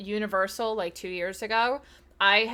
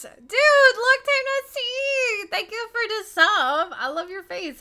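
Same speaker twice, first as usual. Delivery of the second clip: high, loud squeal of welcome; drawn-out, high-pitched fangirl greeting; excited greeting; 13 semitones higher, 9 dB louder, slower than usual. Real, not acted. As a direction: gush at a long-lost friend. Streamer excitedly greets a returning viewer.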